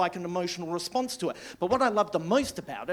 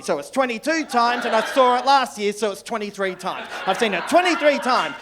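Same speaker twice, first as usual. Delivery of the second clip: escalating count; 4 semitones higher, 7 dB louder than usual. Real, not acted.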